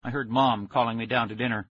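ordinary delivery

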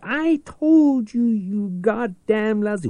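high, drawn-out screamed I told you. Acted. The Peeper screams at his old enemy, shrill and loud. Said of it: "the Peeper screamed".

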